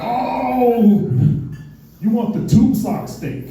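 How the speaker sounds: put-on string the kid along with a long oh; knowing tease. Older dudes teasing the naive nineteen-year-old, knowing tease.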